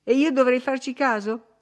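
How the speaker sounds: high, loud scornful retort; piqued and dismissive; irritated retort. A narrator's reading. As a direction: snap back, pitched well up. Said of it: "ribatté Catherine con irritazione".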